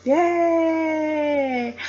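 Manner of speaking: high, long-held yay; celebratory cheer. Real, not acted. Vlogger celebrating six hundred subscribers, high drawn-out cheer. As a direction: stretch out a high cheer without shouting.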